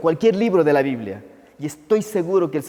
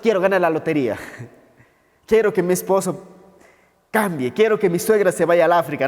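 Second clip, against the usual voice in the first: mock wishful prayer. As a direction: playfully imitate someone begging God for favors. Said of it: "No está diciendo". (imitando en broma una súplica interesada)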